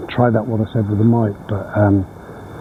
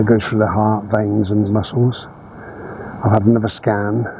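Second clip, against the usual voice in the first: usual speaking voice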